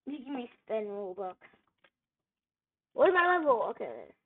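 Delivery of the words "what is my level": frustrated shout. Real, not acted. Frustrated gamer checking his level.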